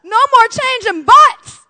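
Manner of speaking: high, loud, drawn-out joyful shout; hallelujah cheer. Retold or imitated. Mom celebrating the end of diapers, shouting for joy. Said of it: "I was like".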